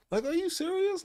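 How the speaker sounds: high incredulous question. Real, not acted. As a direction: pitch it up in disbelief. Incredulous at people.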